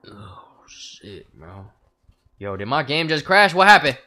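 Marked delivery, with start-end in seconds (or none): 2.4-4.0 loud, high exasperated call; frustrated outburst. Real, not acted. Streamer whose game crashed, loud exasperation. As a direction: shout it in disbelief.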